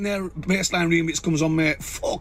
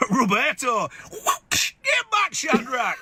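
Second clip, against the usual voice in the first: higher shouted joke line; yelled punchline; 6 semitones higher, much slower than usual. Acted. Joker yelling his punchline to friends.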